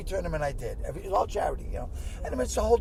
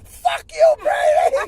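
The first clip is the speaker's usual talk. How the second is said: furious screaming yell, much higher and louder; fan yelling; 13 dB louder, much slower than usual. Retold or imitated. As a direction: scream it at the field like a crazed fan. Fans yelling at the game.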